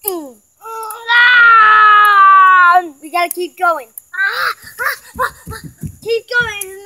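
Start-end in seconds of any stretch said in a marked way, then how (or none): 0.6-2.8 loud cry at the sour taste; pained cry. Real, not acted. Sour candy challenge. 4.1-5.6 gasping laughing cries; laugh-cry through the sourness. Real, not acted. Sour candy challenge.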